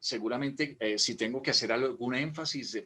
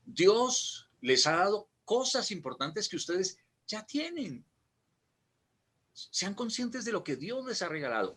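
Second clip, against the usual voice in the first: varied tone sermon; 4 semitones higher than usual. Shown as a demonstration. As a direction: preach with shifting pitch for emphasis. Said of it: "un ejemplo".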